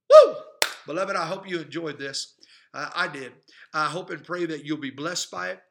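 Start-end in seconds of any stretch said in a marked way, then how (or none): 0.1-0.4 woo. Real, not acted. Joyful shout ending prayer.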